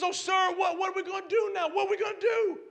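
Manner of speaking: high, loud panicked plea to his master; panicked cry. Acted. Servant crying out to Elisha in panic, loud and high. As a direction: shout it out like a frightened servant. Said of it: "He crying out to Elisha".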